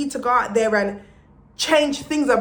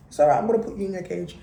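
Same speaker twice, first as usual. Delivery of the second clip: pet talk; 4 semitones lower, faster than usual. Real, not acted. Aside to her pet.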